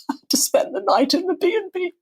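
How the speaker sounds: hammed-up poor-me whisper; mock self-pity. Actress mocking her lonely night after the failed Abbey audition, hushed.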